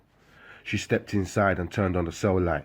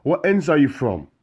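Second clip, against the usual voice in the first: high, loud angry yell through a prison window; shouting across the wing at the new man; 9 semitones higher, 6 dB louder than usual. Acted. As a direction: bellow out of the cell window. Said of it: "shouted from outside the window".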